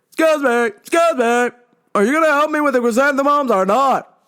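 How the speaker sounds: yelling deaf customer. Retold or imitated. Deaf customer follows worker demanding help, imitated high yelling. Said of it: "he's yelling back at me".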